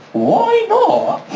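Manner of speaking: very high, incredulous squeal of protest; mock baffled protest. Rejected applicant questioning the talent show, mock-outraged. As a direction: shoot the voice right up in disbelief.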